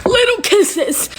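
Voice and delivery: "Little kisses" high and drawn out in relief; overjoyed, slow "Little kisses"; relieved. Real, not acted. Relieved repeat of the answer.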